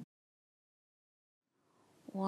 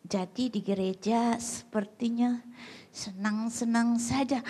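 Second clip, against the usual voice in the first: normal talking voice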